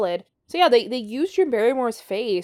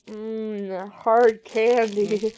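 mouth full; much slower than usual. Real, not acted. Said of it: "I'm gonna put a hard candy in my mouth".

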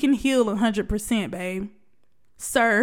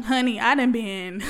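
ordinary talk

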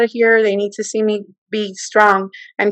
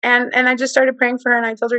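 normal talking voice

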